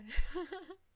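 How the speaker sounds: high, loud burst of laughter. Real, not acted. Laughing at joke about accents.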